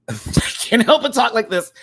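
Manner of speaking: high, loud laughing protest; giggling at herself. Real, not acted.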